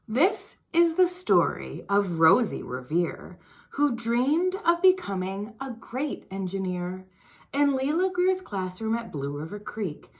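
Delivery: put-on lively storybook read-aloud for kids; reading to children. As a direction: read it like story time for little ones. Reads picture book to children.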